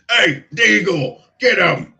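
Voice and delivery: loud, high holler across a bar; slow, drawn-out shout; orc shouting orders. Acted. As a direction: call your partner over to attack. Orc calls to his partner.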